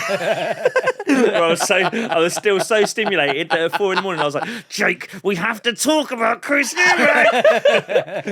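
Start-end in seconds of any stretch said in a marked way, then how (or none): 4.7-8.2 slow, loud laughing urgency; wired excitement. Retold or imitated. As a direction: insist it, buzzing on stimulants. Wired at four am, retold.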